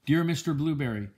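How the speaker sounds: ordinary delivery, nothing marked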